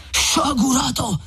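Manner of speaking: slow, high cry of reproach; full, resonant cry. A narrator's reading. Friar repeats his rebuke to Renzo, drawn-out ringing cry. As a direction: draw the rebuke out, high and sonorous. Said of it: "gridò il padre Cristoforo".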